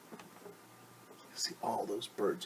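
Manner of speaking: slow hushed whisper. Real, not acted. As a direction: lean in and whisper. Pointing at picture to children.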